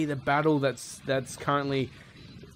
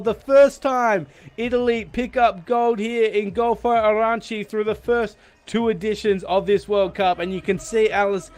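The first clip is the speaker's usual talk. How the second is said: elated commentary; 6 semitones higher, 4 dB louder than usual. Real, not acted. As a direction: still buzzing after the win, raised. After the win, still elated.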